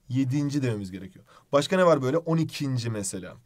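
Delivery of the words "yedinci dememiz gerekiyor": normal talking voice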